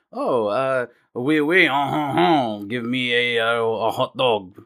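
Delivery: hammed-up French accent with nasal-style chortle syllables. Shown as a demonstration. Host contrasting it with Russian, French accent. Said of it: "French is like".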